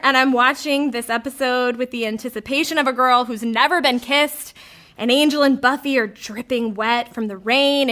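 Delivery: normal talking voice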